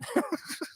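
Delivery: short unvoiced chuckle; soft laugh at one's own silliness; amused laugh. Real, not acted. Streamer chuckling at his own accent attempt.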